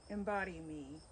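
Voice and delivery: normal talking voice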